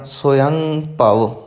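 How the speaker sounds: ਸਵਯੰ ਭਵ said "so-yang pav"; ਸਵਯੰ ਭਵ with the same "ang" nasal. Shown as a demonstration. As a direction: hold the ng of ਸਵਯੰ before ਭਵ. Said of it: "ਉਸੇ ਤਰ੍ਹਾਂ".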